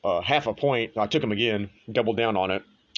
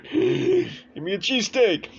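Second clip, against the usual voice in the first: slow, much higher hammed-up Philly accent; 3 dB louder, much slower than usual. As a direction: order a cheesesteak in an overdone Philly accent. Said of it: "I don't even know if that's a Philly accent".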